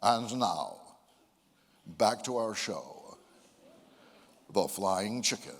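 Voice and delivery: deep, slow announcer voice; broadcaster voice. Shown as a demonstration. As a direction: boom it like a radio host. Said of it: "to speak like an American broadcaster is to speak like this".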